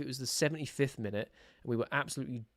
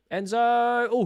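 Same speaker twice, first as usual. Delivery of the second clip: higher, louder cry of the keeper's name; loud call; 10 semitones higher, 10 dB louder, much slower than usual. Real, not acted. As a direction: call his name at the big save. Fan calling out at a save.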